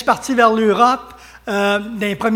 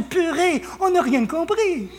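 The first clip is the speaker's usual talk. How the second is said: higher voice of a baffled listener; imitated French woman; 7 semitones higher, 4 dB quieter than usual. French woman who understood nothing of the sermon, lightly imitated. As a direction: mimic her puzzlement, pitched up. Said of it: "Mais elle dit".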